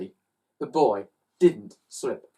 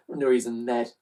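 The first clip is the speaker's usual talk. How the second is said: ordinary delivery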